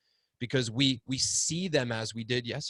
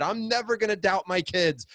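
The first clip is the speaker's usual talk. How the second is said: higher vow; 9 semitones higher, 3 dB louder than usual. Retold or imitated. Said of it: "I always said".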